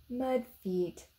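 ordinary delivery, nothing marked

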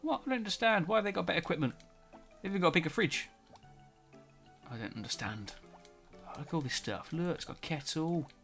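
ordinary talk

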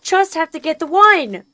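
high, loud frustrated yell. Real, not acted. Gamer stuck on the puzzle, high loud frustrated yell. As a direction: shout it out in frustration.

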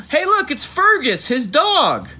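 high, loud delighted exclamation; excited discovery. Acted. Reading to a child, spotting the dog Fergus excitedly. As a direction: point at the dog with glee.

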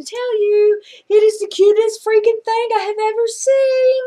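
high gushing squeal. Real, not acted. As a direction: squeal over how cute it is. Gushing over cute dogs.

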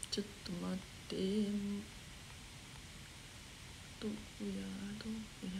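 soft, half-whispered searching mutter; barely voiced, talking to herself while searching; muttering under breath. Real, not acted. Idol scrolling for a comment, muttering softly to herself.

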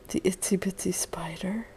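soft whispered guess. Real, not acted. Mother guessing son's thoughts, whispering the guess. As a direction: drop to a playful whisper.

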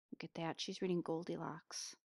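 ordinary delivery, nothing marked

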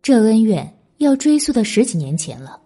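usual speaking voice

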